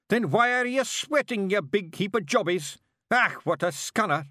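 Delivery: high, loud Scottish yell of a tiny angry warrior; angry Scottish pictsie. Acted. Rob Anybody shouts at hiver.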